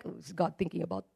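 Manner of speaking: hushed imitation of a nervous churchgoer; mock anxious believer. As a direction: voice a timid believer fretting over old sins. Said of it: "you're not like".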